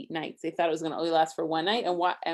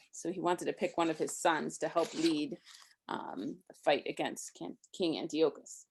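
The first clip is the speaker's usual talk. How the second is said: ordinary delivery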